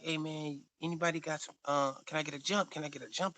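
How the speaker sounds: lightly voiced bum. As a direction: lightly imitate a stranger begging a favour. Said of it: "he hold him up, and he like".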